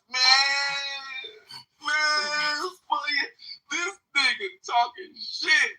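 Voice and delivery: very high, tearful whining imitation; mock crying yell. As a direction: impersonate a kid crying and yelling at once. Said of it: "He sound like he crying and yelling at the same time".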